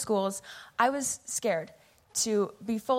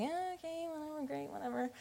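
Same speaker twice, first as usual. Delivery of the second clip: much softer, high, bored brush-off; dismissive mumble; 6 semitones higher, 12 dB quieter than usual. Retold or imitated. Teen brushing off church people praying for her, dismissive and much softer. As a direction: toss it away like you couldn't care less. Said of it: "and I was just like".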